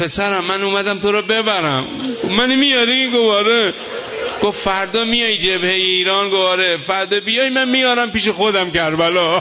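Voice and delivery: very high, loud climactic wailing; preaching at full force. Acted. Preacher voicing the holy figure's promise to the son, full-force wail. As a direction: cry it out at the top of the voice. Preacher voices dream dialogue at peak.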